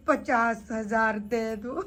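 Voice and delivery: slow, low words dissolving into laughter; helpless amusement. Real, not acted. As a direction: draw it out, then crack up. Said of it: "मुझे हंसी आ रही बहुत". (धीमे खींचकर, हँसी में फूटते हुए)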